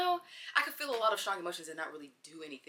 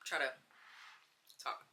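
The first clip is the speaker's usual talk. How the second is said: talking with mouth full; 3 semitones lower, 5 dB quieter than usual. Real, not acted. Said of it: "take a bite of this and then I'm gonna try to talk".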